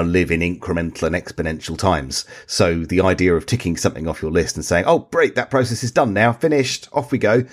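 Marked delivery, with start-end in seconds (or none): 4.9-7.4 high, sing-out cheerful dismissal; mock breezy satisfaction. Someone ticking a task off the list, breezy higher-pitched relief. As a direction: lightly act out being glad it's finished.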